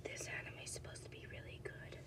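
hushed, breathy whisper as she browses. Acted. Whispering while browsing stickers.

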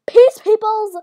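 high, very loud celebration; triumphant shout. Real, not acted. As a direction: crow it loudly to everyone. Celebrating landing first try.

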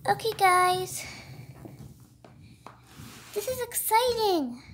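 ordinary talk, nothing marked

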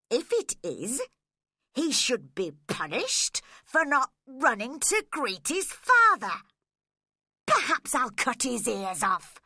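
put-on bully the child, threat building up; threatening father. Hindley threatens his frightened son.

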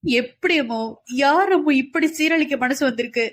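high, tearful sobbing question; childlike weeping; sobbing like a child. A narrator's reading. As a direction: sob out the words like a small boy. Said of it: "சிறு குழந்தையென தேம்பி தேம்பி அழுதான்".

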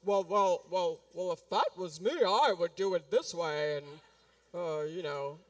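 low, smug know-it-all. Retold or imitated. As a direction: play the clever skeptic doing it his way. S0 voices a know-it-all objection.

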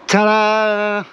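loud high showman's ta-da; ta-da announcement. Real, not acted. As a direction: present it with a flourish. Unveiling the rebuilt bike.

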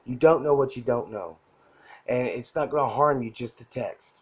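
ordinary talk, nothing marked

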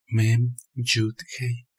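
"Mem Yud Hei" as three separate Aramaic letter names, slowly; Aramaic pronunciation. Shown as a demonstration. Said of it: "En arameo se pronuncia".

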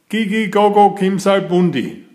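high, quick mock alien reply; answering in alien talk. A narrator's reading. Child answering the green men, quick high mock alien talk. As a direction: rattle off the alien words brightly. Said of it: "I answered".

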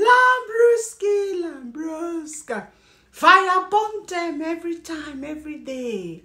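slow, stretched call starting high and falling; drawn-out catchphrase call. Real, not acted. Host greeting viewers with her catchphrases, drawn-out call. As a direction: draw out each word like a signature chant.

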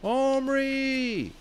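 very high, held roar of the name; father shouting from the foot of the stairs. A narrator's reading. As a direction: bellow up the stairs. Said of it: "roaring at him".

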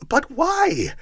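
high, loud cry of protest. A narrator's reading. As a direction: cry out in disbelief. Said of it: "cried Scrooge's nephew".

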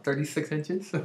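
normal talking voice

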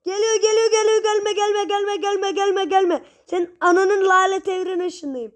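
high, rushed frantic repetition; panicked babbling. Real, not acted. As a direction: panic as the thing closes in. Enemy approaching, panic.

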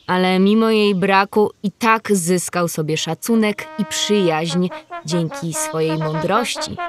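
ordinary delivery

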